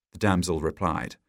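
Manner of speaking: ordinary delivery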